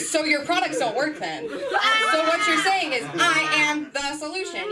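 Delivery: high-pitched triumphant retort, hammed up; mock indignant comeback. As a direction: argue back as if proving a point. Acted comeback to boss.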